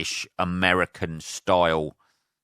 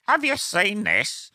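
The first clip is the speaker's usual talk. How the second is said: much higher, slow sing-song question; mock observational comic; 13 semitones higher, slower than usual. Mocking lazy stand-up again, high slow sing-song. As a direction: send up a hack comic's catchphrase. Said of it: "rather than".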